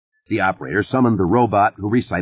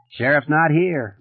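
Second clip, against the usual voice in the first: slow, high, utterly unimpressed; a deputy who shrugs off the urgency; slow and unbothered deputy brushing off the caller; 8 semitones higher, slower than usual. A narrator's reading. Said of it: "sounding monumentally unimpressed".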